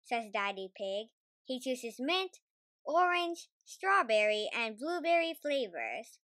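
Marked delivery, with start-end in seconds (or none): none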